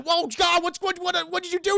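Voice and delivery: high, stammering mock panic; acted alarm. Acted alarm at screaming creature.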